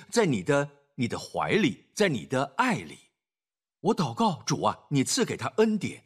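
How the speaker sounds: low, hushed intercessory prayer voice. Acted. Pastor praying over a troubled son, low and hushed. Prays aloud for him.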